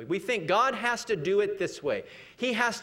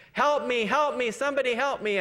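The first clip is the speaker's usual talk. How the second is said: higher, pleading call for help; hammed-up cliff-hanger calling out; mock cry for help; 6 semitones higher than usual. Man hanging from cliff calling for help, mock panic. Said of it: "he's saying".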